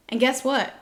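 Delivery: usual speaking voice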